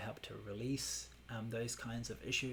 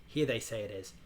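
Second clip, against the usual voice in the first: usual speaking voice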